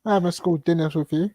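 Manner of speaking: high-pitched mock protest; mock indignant mate. Denying old school ties to a mate, mock indignant. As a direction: lightly play the affronted lad.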